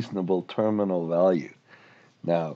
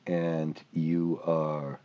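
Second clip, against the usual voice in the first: normal talking voice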